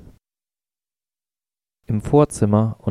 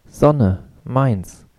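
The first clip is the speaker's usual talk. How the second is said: put-on angry child's scream; 4 semitones higher than usual. A narrator's reading. Toddler shouting after being carried out of the sun, angry cry. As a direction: scream it in a tantrum, trembling.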